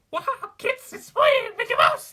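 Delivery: very high, loud falsetto cartoon mouse; failed cartoon mouse squeak, far too high; Mickey Mouse. Shown as a demonstration. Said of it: "I used to be able to do Mickey".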